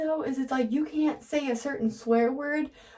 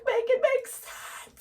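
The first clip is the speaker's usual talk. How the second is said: exasperated high voice; 13 semitones higher, slower than usual. Retold or imitated. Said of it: "'cause I'm like".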